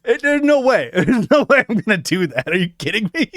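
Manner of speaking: high laughing refusal; laughing disbelief. Real, not acted. Streamer refusing to rap to Rap God, laughing.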